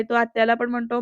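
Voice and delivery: usual speaking voice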